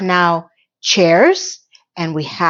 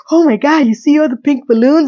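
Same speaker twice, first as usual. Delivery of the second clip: mock over-the-top delight, pitched higher; exaggeratingly happy; 5 semitones higher, 3 dB louder than usual. Imitating Miranda gushing over pink balloons, overly happy. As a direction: gush like a hyped-up friend. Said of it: "overly exaggeratingly happy".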